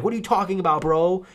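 high, loud indignant protest; defensive disbelief; indignant LeBron. Retold or imitated. LeBron denies stealing the powers, high indignant protest.

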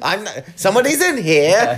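put-on loud, high comedian accent; John Mulaney. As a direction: call it out in a stand-up's odd accent. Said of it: "said this in a weird fucking accent".

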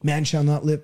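low, toneless, meek recital; mock timid Bible quoter; timid scripture quoting. Shown as a demonstration. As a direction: quote it with no authority at all, voice dropped low. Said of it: "That's not how Jesus said".

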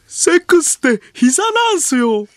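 loud, very high mock shout; hammed-up proclamation. Mimicking a comedian boasting about his theory, high loud proclamation. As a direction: proclaim the theory at the top of your voice.

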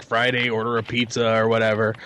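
ordinary talk

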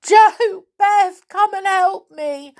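high, loud wail for help; sobbing call. A narrator's reading. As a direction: wail desperately to friends for help. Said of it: "sobbed Connie, crying tears of anger and despair".

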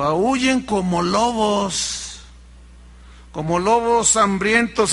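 drawn out, stretching aúllen slowly; slow, heavy rebuke; slow commanding preach. Acted. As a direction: preach aúllen como lobos slowly and heavily. Preacher commands artists to howl.

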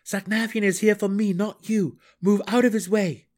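high and loud, pressing; urgent warning shout. A narrator's reading. Drow shouts warning across cavern.

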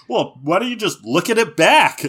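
lightly hammed-up high, loud voice; mock Ash suggestion. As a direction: put on an eager cartoon trainer. Acted Ash line, high and loud.

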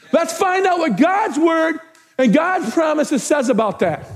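deliberate, ringing repeat; emphatic preaching. Real, not acted. Pastor urging church toward God's promises, emphatic preaching. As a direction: hammer each phrase to the church.

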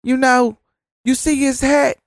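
high, gushing imitation of a starstruck fan; mock a giddy woman cooing over a hat; high, gushing impression of the McDonald's lady swooning over a Trump hat. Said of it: "And so she say".

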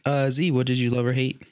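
normal talking voice

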